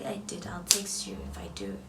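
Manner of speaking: soft unvoiced brush-off; tired brush-off getting out the car; dismissive whisper. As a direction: throw it away quietly. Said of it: "I was like".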